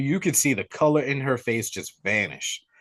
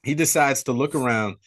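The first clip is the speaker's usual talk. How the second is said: ordinary talk, nothing marked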